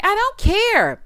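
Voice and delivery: high, loud bratty retort; bratty dismissal. Retold or imitated. Imitating a housemate brushing off the warning, loud. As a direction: snap it back high and loud. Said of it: "She gonna say".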